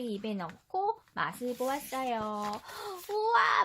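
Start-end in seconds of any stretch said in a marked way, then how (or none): 3.1-3.6 high bright gasp of delight; delighted wow. Acted. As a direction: marvel at the taste. Prince tastes vegetables. (감탄하는 우와)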